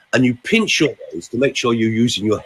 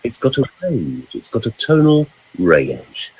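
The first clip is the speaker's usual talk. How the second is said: slow motion; slower than usual. Shown as a demonstration. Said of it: "slow motion".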